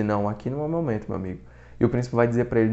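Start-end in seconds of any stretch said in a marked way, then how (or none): 0.0-1.4 quick, soft, lower-pitched brush-off; self-possessed haughtiness. Retold or imitated. Prince putting off his challenger, coolly self-possessed. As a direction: dismiss him quietly, imitating a composed prince. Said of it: "essa presença de espírito de dizer".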